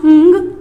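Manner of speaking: "ंग" as a nasal ng from the back of the throat; ng sound. Shown as a demonstration. Teacher showing the ng sound to parents. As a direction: hum "ंग" through the nose, no full g. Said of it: "एन जी का sound".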